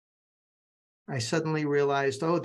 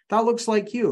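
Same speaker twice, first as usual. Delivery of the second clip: excited; 9 semitones higher, 6 dB louder than usual. Real, not acted. Pointing at own screen image.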